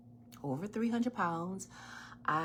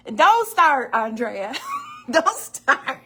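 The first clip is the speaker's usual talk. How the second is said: loud, laughing warning to a friend; playful loud scolding; laughing don't start; 7 semitones higher, 12 dB louder, slower than usual. Real, not acted. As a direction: tell her off through laughter. Teasing Andrea off mic.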